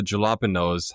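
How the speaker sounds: jalapenos with an English J; pronounced with J. Retold or imitated. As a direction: say jalapenos as spelt, "juh-lah-puh-nose". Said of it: "How is a J".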